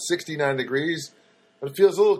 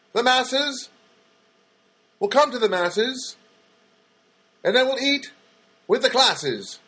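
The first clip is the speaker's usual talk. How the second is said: much higher, louder pompous candidate; a pope hopeful hamming up promises, far higher; mock campaign speech; 10 semitones higher, 3 dB louder than usual. Comedian playing a pope candidate campaigning, hammed-up high speech.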